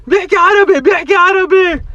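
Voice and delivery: put-on very high, loud kids' exclamation; imitated kids. Kids finding out the stranger speaks Arabic, imitated high squeal. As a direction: squeal it out like astonished children. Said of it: "the kids were like".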